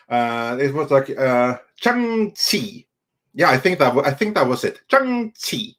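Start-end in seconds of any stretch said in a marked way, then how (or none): 1.8-2.9 "Shang-Chi" very slow, as "chung-tee"; pronunciation demo. Shown as a demonstration. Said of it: "how it's supposed to be pronounced". 4.9-5.7 "Shang-Chi" pitched high, falling, as "shung-tee"; correct-pronunciation demo. Shown as a demonstration. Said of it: "how it's supposed to be pronounced".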